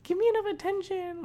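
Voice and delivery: very high mock-crying girlfriend voice; whiny crying. Mocking his ex begging for attention, whiny crying. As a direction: mimic a needy ex whining in falsetto. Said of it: "she kept fucking bothering me and crying".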